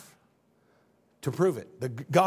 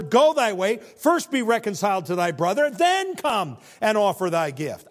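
loud, raised pulpit reading; scripture proclamation; 6 semitones higher, 7 dB louder than usual. Real, not acted. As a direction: proclaim the verse with mounting force.

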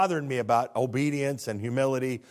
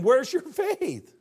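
high, slow, stretched rebuke; drawn out, every word weighed; pointed question; 11 semitones higher, 5 dB louder, much slower than usual. Retold or imitated. Jesus questioning the frightened disciples, slow and high. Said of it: "And he says".

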